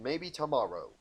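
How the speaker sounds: ordinary delivery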